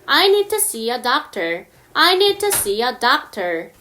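I need to see a doctor, spoken very slowly with an R; careful American read; slow demo; slow example. Shown as a demonstration. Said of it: "예문을 들어볼게요".

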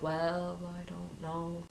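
a doubtful, dropping mumble; unsure mumble. Retold or imitated. A doubter voiced by the preacher, shrugging off God's love, in a soft mumble. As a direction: mumble it away as if you don't care.

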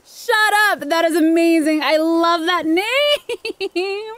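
high, gushing amazement; excited high exclamation; delighted disbelief. Real, not acted. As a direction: burst out thrilled, breaking into a laugh. Delighted disbelief at island name.

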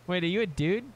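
ordinary talk

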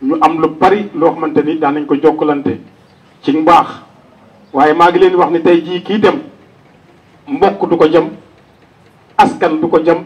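ordinary delivery

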